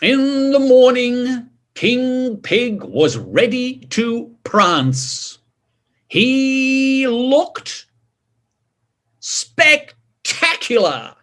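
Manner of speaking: slow, showy read-aloud; storybook narration. A narrator's reading. Reading to children as the king shows off, slow showy narration. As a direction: present the king's grand outfit with flair.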